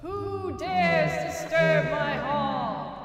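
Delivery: slow, booming haunted voice; spooky ghost voice. Acted. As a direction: menace them like a ghost guarding her hall. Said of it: "Sound like she needs some cough drops".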